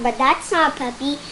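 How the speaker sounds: usual speaking voice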